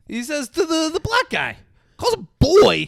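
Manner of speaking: shocked, voice jumping up high; outraged disbelief. Real, not acted. Telling his co-host about the slur, loud outraged disbelief.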